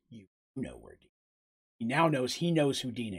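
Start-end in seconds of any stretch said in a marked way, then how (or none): 0.1-1.8 low hushed realization. Acted. Man reacts to hearing Dina's name.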